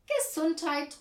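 high, loud cheery blessing; child calling out. A narrator's reading. Child calls to the sneezing visitor, high and loud. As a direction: sing out a bless-you. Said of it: "ruft Anne".

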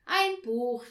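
calling out. A narrator's reading. Papa guessing the present, calling out loudly. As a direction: shout it as if solved. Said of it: "rief Papa".

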